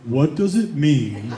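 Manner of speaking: low, slow mock-grave question; imitated son. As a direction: draw it out low as the suffering son. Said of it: "And he goes".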